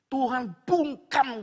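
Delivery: loud, high, stretched-out words; drawn-out pulpit emphasis; emphatic preaching. Real, not acted. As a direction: proclaim it forcefully and slowly. Preacher stresses God's silence.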